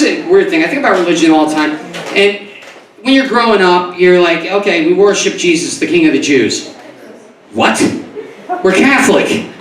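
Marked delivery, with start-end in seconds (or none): none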